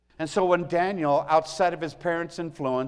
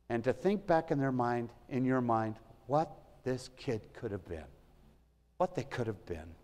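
softer, lower, reflective delivery; quiet regret; 3 semitones lower, 7 dB quieter than usual. Real, not acted. Former teacher reflecting on what a kid could have been, quietly regretful. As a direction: let the voice sink in quiet regret.

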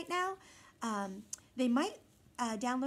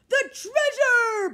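much higher, loud slow boom; booming crew; 12 semitones higher, 13 dB louder, much slower than usual. A narrator's reading. Pirate crew crying for the treasure. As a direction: boom it out in dismay. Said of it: "boomed the crew".

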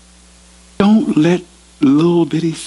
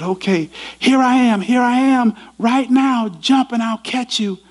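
urgent father; 9 semitones higher than usual. Retold or imitated. Father urges jump.

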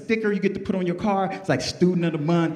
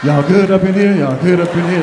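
loud, booming check-in; loud party dad; 8 dB louder than usual. Retold or imitated. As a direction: holler over the music like the host.

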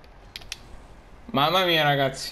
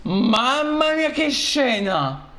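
breathless italian goal commentary; 8 semitones higher than usual. Real, not acted. Celebrating an epic goal.

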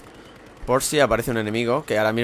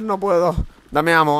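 ordinary talk, nothing marked

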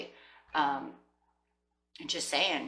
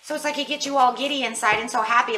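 high, loud, bubbling over; giddy excitement; 6 semitones higher, 6 dB louder than usual. Real, not acted. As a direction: gush with giddy joy.

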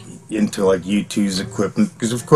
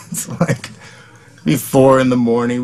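usual speaking voice

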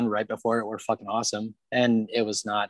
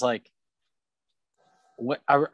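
ordinary talk

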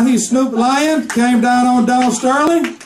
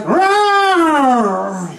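lion roar. Acted. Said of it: "And he would be like".

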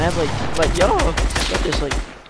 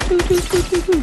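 much higher squeaky mimic; high-pitched mimic; 10 semitones higher than usual. Mocking Daniel's callout.

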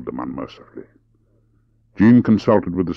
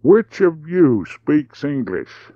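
deliberate, word-by-word drawl; high and slow, a gap between words; 9 semitones higher, 5 dB louder, much slower than usual. Acted. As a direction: drawl it out, louder and higher. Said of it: "He spoke deliberately in a slow drawl".

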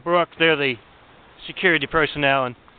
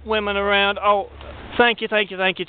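higher, over-sweet thank-yous, hammed up; gushing thanks; 4 semitones higher than usual. Thief thanking the guards as she runs out, gushing. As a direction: lay the gratitude on thick, pitched up.

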